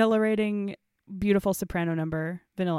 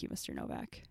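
whispered, barely voiced name; breathy whisper; 13 dB quieter than usual. Amalia's sincere confession quoted.

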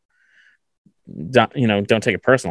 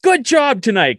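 angry praise, retold; 13 semitones higher, 4 dB louder, slower than usual. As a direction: praise yelled in a high, heated voice. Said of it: "tone it down because I was just getting so angry".

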